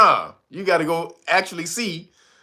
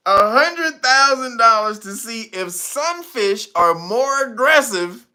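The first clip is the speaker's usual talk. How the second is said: normal talking voice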